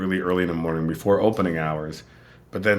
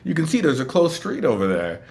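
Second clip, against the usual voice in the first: high, eager retold announcement; excited hotel staff, pitched up; imitating excited locals; 9 semitones higher than usual. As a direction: share big local news eagerly. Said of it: "people in Cleveland being excited".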